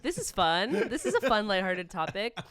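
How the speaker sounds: laughing, loud, ironic; sarcastic laugh. Real, not acted.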